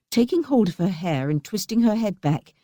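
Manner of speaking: usual speaking voice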